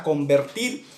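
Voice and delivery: usual speaking voice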